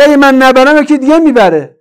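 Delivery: high, loud retold protest; loud mock excuse. Preacher quoting the looter's excuse to the congregation, loud and high. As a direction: hurl the quoted excuse out indignantly.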